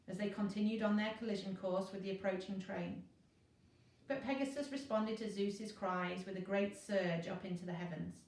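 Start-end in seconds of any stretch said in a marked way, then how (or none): none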